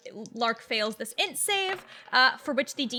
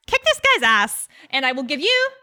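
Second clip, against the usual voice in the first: rallying cry; 5 semitones higher, 5 dB louder than usual. Acted. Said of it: "I'm gonna be like".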